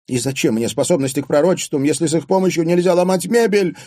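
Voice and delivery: high, fast, angry outburst; furious exclamation. A narrator's reading. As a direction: rail furiously at your own helplessness. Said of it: "яростно воскликнула". (яростно восклицая)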